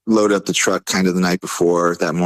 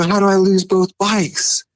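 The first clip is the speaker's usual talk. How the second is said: high, slow exclamation of mock panic; retold disbelief; 13 semitones higher, slower than usual. Driver finds both bikes missing, retold disbelief. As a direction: wail the question, stretched out high.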